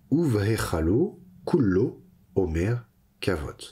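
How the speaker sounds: "Uvrechalo kullo omer kavod" read clearly in Hebrew; Hebrew verse read aloud. Shown as a demonstration. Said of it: "On passe à la suite". (lecture du verset en hébreu)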